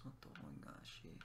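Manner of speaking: breathy whisper, barely there; hushed repeat of the coin line. Shown as a demonstration. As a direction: whisper the wish to yourself.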